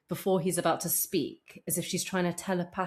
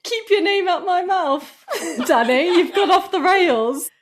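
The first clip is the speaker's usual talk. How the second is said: high, loud, drawn-out mock telling-off; Seth Rogen; 13 semitones higher, 10 dB louder than usual. Celebrity telling off Danny, high, loud mock outrage. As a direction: imitate an indignant celebrity, loud and half laughing. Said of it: "It's like".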